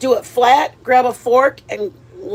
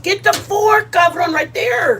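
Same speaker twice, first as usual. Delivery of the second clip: playful bossing; 8 semitones higher, slower than usual. Pretend.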